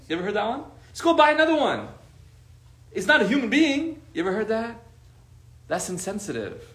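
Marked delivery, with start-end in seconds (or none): 0.9-4.0 high-pitched, louder mock dismissal; dismissive friend. Insensitive friend about a dead pet, dismissive. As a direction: play the callous friend, voice shooting up.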